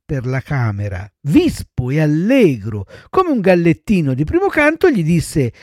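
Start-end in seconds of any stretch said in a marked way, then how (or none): none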